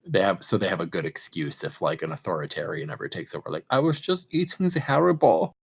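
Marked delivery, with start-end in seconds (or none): 3.7-5.5 put-on high-pitched, harmless German accent; goofy innocent German accent, pitched up. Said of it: "your inconsequential German person voice".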